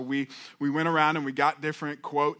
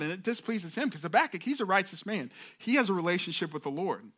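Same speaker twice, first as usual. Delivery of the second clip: normal talking voice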